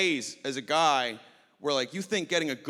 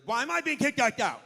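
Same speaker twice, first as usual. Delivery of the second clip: indignant protest. Retold or imitated. Ejected woman protesting to the bouncer, high and indignant. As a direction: protest higher each time. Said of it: "she was very vocal".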